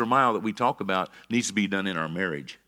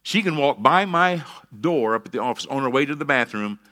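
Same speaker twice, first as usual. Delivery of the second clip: ordinary delivery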